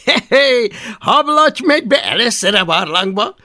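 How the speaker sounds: put-on loud, high, swaggering boy leader; bossy bully leader. Boastful Viking boy bossing the others, loud and high. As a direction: bark orders like the self-made chief. Leader pushes Hablaty forward.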